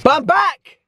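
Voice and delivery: high, exuberant shout; excited comeback cry. Real, not acted. Vlogger tells viewers he's back after ages, excited cry. Announcing his return to vlogging.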